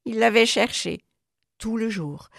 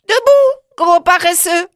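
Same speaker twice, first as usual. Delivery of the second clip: much higher, loud cheerful call; little girl rousing her lazy dog; joyful wake-up call; 11 semitones higher, 12 dB louder than usual. Acted. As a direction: bounce the dog awake with glee. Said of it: "lui dit-elle joyeusement".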